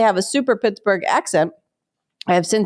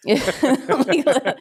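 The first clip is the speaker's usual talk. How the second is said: laughing; 7 semitones higher, much slower than usual. Real, not acted. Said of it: "joke repeated".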